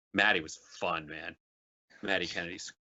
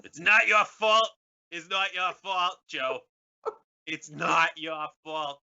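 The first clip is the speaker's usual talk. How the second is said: loud, high Boston movie line; Good Will Hunting quote; 6 semitones higher, 4 dB louder, slower than usual. Retold or imitated. Guest quoting the movie scene at Joe, loud and high. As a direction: deliver the famous film line, ramped. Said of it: "Goodwill Hunting goes to Washington".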